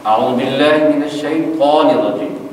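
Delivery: slowly recited, thick emphatic ط in الشیطان; heavy ta. Shown as a demonstration. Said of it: "یہ تلفظ".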